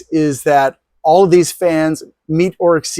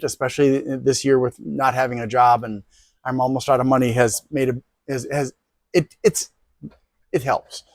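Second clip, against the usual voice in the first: softer, faltering voice, real emotion held in; holding back emotion; 3 semitones lower, 6 dB quieter than usual. Real, not acted. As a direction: struggle quietly to get the words out. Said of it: "I'm trying not to get emotional here".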